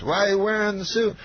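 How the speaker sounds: high-pitched mimicked question; Bill Cosby. Veteran comic quizzes opener about suit, mimicked high. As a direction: imitate the star, pitched well up. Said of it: "And he says".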